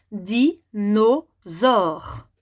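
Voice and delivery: "dinosaure" in three slow syllables, di, no, saure; découpage en syllabes. Shown as a demonstration. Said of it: "les petits carrés pour le mot". (« dinosaure » syllabe par syllabe : di… no… saure)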